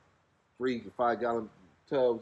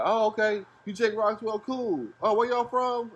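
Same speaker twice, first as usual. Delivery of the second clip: higher, excited host voice; imitating the host; 9 semitones higher than usual. Host greeting the broke travellers, higher and excited. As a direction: mimic the eager host. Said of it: "And he's like".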